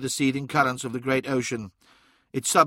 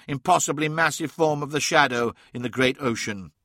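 usual speaking voice

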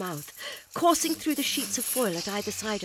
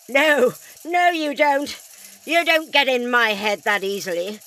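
loud, higher, straining against pain; strained defiance; 4 semitones higher, 11 dB louder than usual. Acted. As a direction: push back hard through the pain. Doctor resisting mind attack.

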